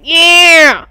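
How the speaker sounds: loud, high triumphant yell; excited whoop. Pretend. Dreaming of chopping trees, loud high whoop. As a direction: whoop it like you just felled a giant tree. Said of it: "and be like".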